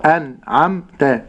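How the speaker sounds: slow أنعمت with a clean vowelless n; still nun. Shown as a demonstration. Sheikh modelling أنعمت, slow with silent nun. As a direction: part أنعمت carefully, an-am-ta, n unmoved. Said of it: "سكون النون".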